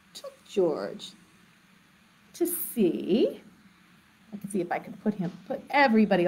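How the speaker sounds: plain reading voice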